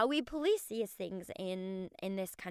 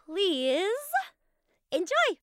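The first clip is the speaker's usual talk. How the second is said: excited sign-off; 11 semitones higher, 7 dB louder than usual. Acted. Sign-off before the interview.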